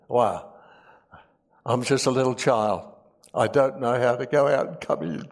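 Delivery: normal talking voice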